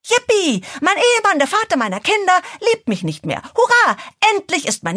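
mock cheer. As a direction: fake a joyful cheer through anger. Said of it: "Soll ich da verdammt noch mal frohlocken".

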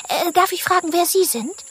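high-pitched, tentative politeness; very polite. A narrator's reading. Little monkey asks the animal he pulled down, very polite. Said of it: "sehr höflich zu sein".

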